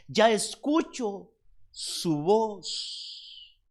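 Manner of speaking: slow, measured reading with a pause before the last words; slow verse reading. A narrator's reading. Preacher reads the bride's words from scripture, slowly. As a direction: let each phrase of the verse hang.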